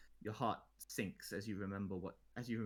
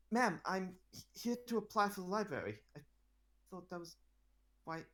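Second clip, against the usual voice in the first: a lightly voiced excited teenage boy, a touch higher; stammering fan meeting his idol; nervous boy; 8 semitones higher, 6 dB louder than usual. Boy applicant blurts out to the queen, lightly voiced and excited.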